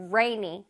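"rainy" opening on a clear English R. Shown as a demonstration. Teacher stressing the R for pupils, clear R sound. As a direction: pull the tongue back to start "rainy". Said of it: "R니까".

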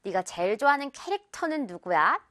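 ordinary delivery

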